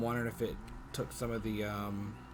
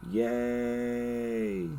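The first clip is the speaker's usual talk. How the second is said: sarcastic cheer; 4 dB louder than usual. Real, not acted. Sarcastic cheer at bad internet.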